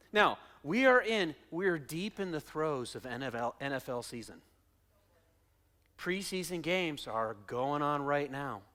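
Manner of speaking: usual speaking voice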